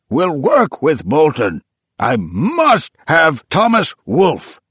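high, loud, slow declaration; fierce insistence. A narrator's reading. Old man to his helpers, high loud fierce. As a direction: demand it with burning conviction. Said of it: "fierce and shining glance".

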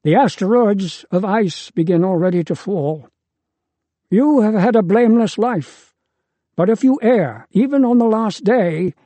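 a father warning his son, pushing harder each line. A narrator's reading. Deucalion urgently warns his son.

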